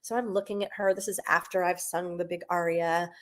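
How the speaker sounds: usual speaking voice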